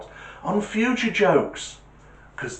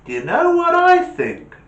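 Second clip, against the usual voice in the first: put-on eager kid; 8 dB louder than usual. Jimmy voiced high.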